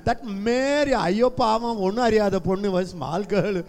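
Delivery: high, loud scoffing doubter; mock the doubting mind; south indian accent. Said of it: "this mind, you know".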